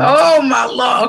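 moved aunt reacting to her nephew's age; overcome. Real, not acted. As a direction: let it out slowly, moved. Emotional at son's age.

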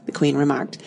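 plain reading voice

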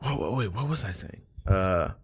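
trailing off. Real, not acted. Loses track of his thought.

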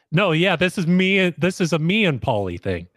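high, eager mock Vinny voice; imitating Vinny. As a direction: butt in like a cocky reality star. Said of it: "Vinny's chiming in on this".

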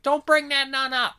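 high, loud imitation of a nun snapping; mock snapping nun. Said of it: "the nun kinda snaps for a second".

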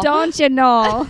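put-on loud, slow Midwest accent with long O. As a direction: echo it in broad Midwest, stretching the vowels. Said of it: "come back talking like that".

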